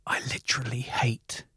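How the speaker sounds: hushed whisper; inner thought whispered. Retold or imitated. Recalls his thoughts about a man at the bar in a whisper. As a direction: whisper it like a secret hate. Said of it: "thinking to myself".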